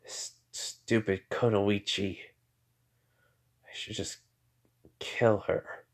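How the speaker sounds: hushed, understated menace with long pauses; quietly angry. A narrator's reading. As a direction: think it aloud, softly and slowly.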